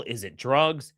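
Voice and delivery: ordinary talk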